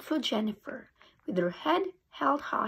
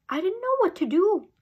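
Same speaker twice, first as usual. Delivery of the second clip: usual speaking voice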